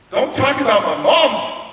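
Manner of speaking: angry outburst. Retold or imitated. Angry boy warning counselors off his mom, loud outburst. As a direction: burst out, loud and defensive.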